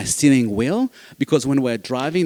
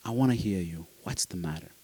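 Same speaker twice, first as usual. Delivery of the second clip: put-on soft, tender comfort for a crying child; gentle soothing; 6 semitones lower, 8 dB quieter than usual. A parent gently asking a crying child what is wrong, softly. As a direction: ease the question out softly, much quieter.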